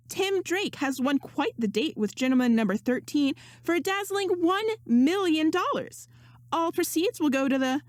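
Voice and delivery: a hint of a chirpy auctioneer; lightly voiced female host. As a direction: lift the pitch a little for the hostess. Host announces winner.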